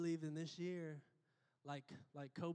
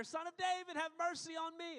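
much higher, louder desperate plea; shouting louder; 13 semitones higher, 5 dB louder than usual. A narrator's reading. Beggar calling to Jesus over the crowd, louder shout. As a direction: cry out even harder. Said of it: "He only shouted louder".

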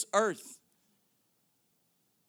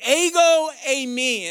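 EGO EIMI drawn out slowly and loud; emphatic quote; 3 semitones higher, 6 dB louder than usual. Retold or imitated. Preacher quoting Jesus's Greek to a congregation, emphatic. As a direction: stretch Ego eimi out with force. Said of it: "it's emphatic. He's, he says".

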